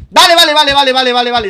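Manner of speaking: rapid, high, loud panicked repeats; panicked vale. Real, not acted. Gamer startled by a figure in the house, panicked high shouting. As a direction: blurt it out in a sudden fright.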